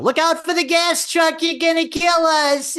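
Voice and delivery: high, loud panicked shriek; yelling. Retold or imitated. Husband screaming at wife driving, high panic. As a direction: scream from the passenger seat. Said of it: "I yell".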